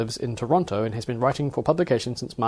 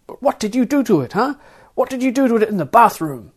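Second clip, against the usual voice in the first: high, loud accusation; accusing guard; 11 semitones higher, 8 dB louder than usual. Acted. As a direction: TS confronts the passenger about the bathroom, high and accusing. TS accusing the passenger.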